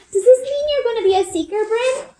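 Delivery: put-on high, bright-eyed little girl asking; little sister Eliza. A narrator's reading. Little sister asking Bryn about becoming a seeker, high childlike voice. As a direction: voice a small excited child, pitched high.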